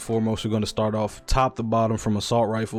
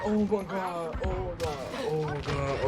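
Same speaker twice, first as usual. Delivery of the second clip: raging gamer; 8 semitones higher, 6 dB quieter than usual. Real, not acted. Relief after finishing grind.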